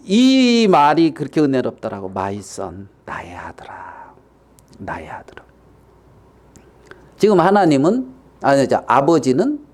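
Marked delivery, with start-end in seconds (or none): 2.1-5.4 very soft, low murmur; hushed, deep-pitched fatherly tenderness; tender father. Acted. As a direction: linger lovingly on the address. Preacher savours father's tender words.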